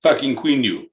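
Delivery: broken-English Russian voice, dropped articles; hammed-up Russian accent. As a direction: play a boastful Russian. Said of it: "in a Russian accent".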